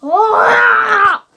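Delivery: loud, high exasperated ah; frustrated shout. Real, not acted. Child annoyed at the story's wording, shouting. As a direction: let out a fed-up yell.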